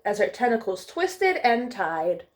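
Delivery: bright, lilting storybook narration; reading to children. Acted. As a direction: read it like bedtime story time for little ones. Reads picture book to children.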